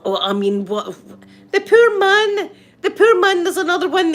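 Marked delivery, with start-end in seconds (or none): 1.5-4.1 loud, high, laughing protest; high-pitched comic indignation; indignant sympathy. Real, not acted. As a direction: cry out in amused outrage for someone. Mock outrage on behalf of man.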